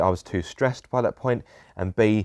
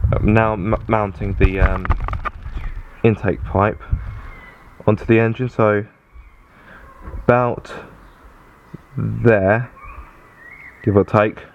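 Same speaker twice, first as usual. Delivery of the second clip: raised voice; 6 dB louder than usual. Real, not acted. Talking over workshop noise.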